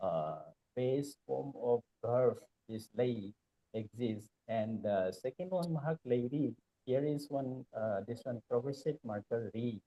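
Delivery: very soft, far from the mic; barely audible, away from the microphone; too quiet. Real, not acted. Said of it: "Some of your voice is very low".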